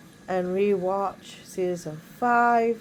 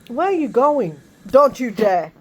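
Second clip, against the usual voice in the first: loud, higher warning; sudden raised-voice telling-off; real scolding call; 3 semitones higher, 9 dB louder than usual. Real, not acted. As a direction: call out sharply to stop them. Breaks off to scold someone nearby.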